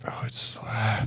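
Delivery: slow hushed whisper. Real, not acted. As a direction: whisper it like it's sacred. Awed reaction to song.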